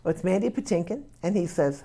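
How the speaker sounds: ordinary delivery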